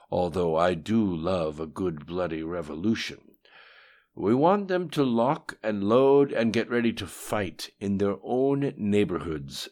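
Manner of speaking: commanding voice character. A narrator's reading. The voice lays out plans to Lim Shun, lightly acted command.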